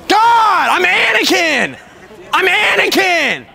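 high, loud yell with drawn-out words; exasperated shout. Real, not acted. Costumed fan mistaken for Kylo Ren, exasperated shout. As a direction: yell your name out in protest.

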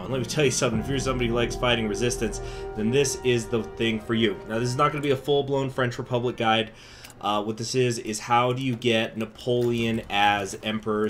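ordinary talk, nothing marked

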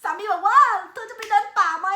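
high, loud excited retelling; excited report. Real, not acted. Telling viewers her husband's hiking idea, high and loud. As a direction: bubble over telling viewers his idea.

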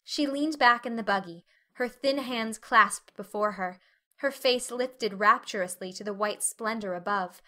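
plain reading voice

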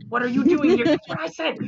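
high, indignant fairy voices; scolding fairy twins. Acted. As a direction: scold the intruder in disbelief. Twin fairies scold intruder.

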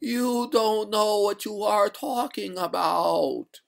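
high, slow, drawn-out ghostly wail; eerie high-pitched ghost, stretching every word; gurgling ghost voice. A narrator's reading. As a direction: intone it slowly like a watery spectre. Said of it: "said the ghost in a gurgling voice".

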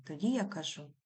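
usual speaking voice

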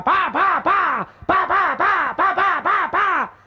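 pa, pa agudo y rápido como disparos; disparos imitados. Retold or imitated. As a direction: dispara con la boca, agudo. Imita los disparos de escopeta. (imitando los disparos de la escopeta)

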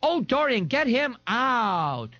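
very high, drawn-out wail; panicked shriek. A narrator's reading. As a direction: wail it high and stretch the end. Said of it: "shrieked in panic".

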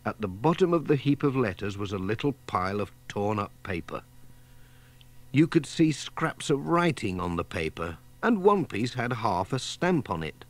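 ordinary delivery, nothing marked

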